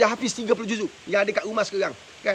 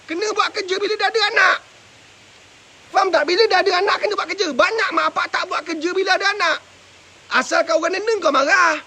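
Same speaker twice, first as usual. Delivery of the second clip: raised, louder preacher's voice pressing the point home; fervent preaching; 5 semitones higher, 4 dB louder than usual. Acted. Preacher scolding parents, raised and forceful.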